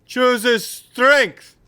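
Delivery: high, loud shouted announcement; yelling giant. Acted. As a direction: call it out to the crowd. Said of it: "yells down into the cave".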